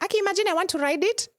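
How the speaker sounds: high, fast giddy gushing; excited rider. Retold or imitated. Beachgoer seeing a horse, high fast excited voice. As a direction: gush like a tourist spotting a horse. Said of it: "you're like".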